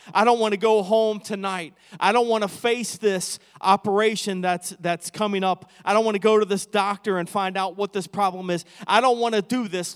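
impassioned preaching. Acted. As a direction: pile up the fears, building. Voices believer's pleading fears, building.

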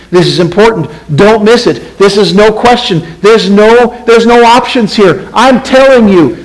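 high, forceful insistence; shouting to sleepy crowd. Acted. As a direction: hammer each short phrase at a sleepy crowd. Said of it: "he's shouting out to a sleepy crowd out there".

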